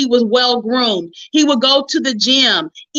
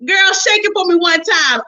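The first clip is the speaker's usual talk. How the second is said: hyping herself up; 6 semitones higher than usual. Retold or imitated. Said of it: "I began to look in the mirror and say".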